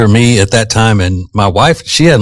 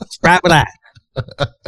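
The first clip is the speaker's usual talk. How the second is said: high, stretched-out Southern vowels; slow country twang on SPRITE and ICE; twangy Southern wife; 7 semitones higher, 4 dB quieter, much slower than usual. Retold or imitated. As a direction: imitate mom's Southern drawl, pitched up. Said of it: "can't get more twangy".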